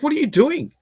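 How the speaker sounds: high-pitched incredulous question; imitating bewildered driver. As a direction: mimic a baffled driver, voice jumping up high.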